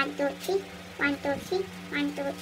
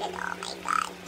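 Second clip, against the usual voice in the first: whispered; 11 dB quieter, much slower than usual. Real, not acted. Pain while peeling mask.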